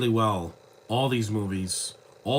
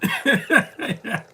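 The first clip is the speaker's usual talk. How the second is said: sudden high giggle at the resemblance; high burst of laughter; 11 semitones higher, 5 dB louder than usual. Real, not acted. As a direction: laugh out loud, high and bright. Laughing at penguin lookalike.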